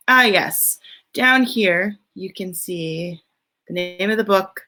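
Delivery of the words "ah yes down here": ordinary delivery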